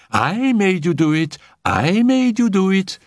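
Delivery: high triumphant crowing, repeated; cried out gleefully. A narrator's reading. Annette gloating over Felicie, crying out high. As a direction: Annette crows her triumph, dancing about. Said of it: "cried Annette".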